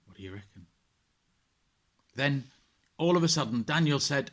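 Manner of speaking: put-on gentle storyteller reading to children; bedtime story narration. A narrator's reading. Vicar reading a Bible story to children at bedtime, gentle narration. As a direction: read it like a bedtime tale, calm and clear.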